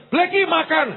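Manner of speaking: high sing-song call to eat; calling a dog. Retold or imitated.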